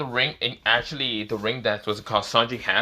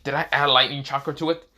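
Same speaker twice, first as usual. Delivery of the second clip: usual speaking voice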